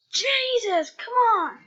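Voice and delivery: loud, high exasperated groan of a line; fed-up gamer outburst. Real, not acted. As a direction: plead with the game in frustration. Game keeps failing.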